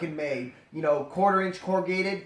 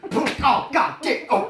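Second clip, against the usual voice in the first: sudden startled outburst; loud startled curse; 9 semitones higher, 6 dB louder, slower than usual. Real, not acted. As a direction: jump at it. Startled exclamation.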